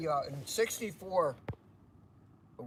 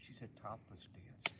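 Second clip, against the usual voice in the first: whispering; 6 semitones lower, 13 dB quieter than usual. Real, not acted. Whispered naughty aside.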